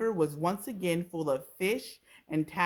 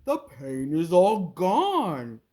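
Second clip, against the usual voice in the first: loud, slow crocodile voice; booming relief; relieved crocodile; 8 dB louder, slower than usual. A narrator's reading. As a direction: crocodile telling the creatures the pain is gone. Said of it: "The crocodile sat up and said".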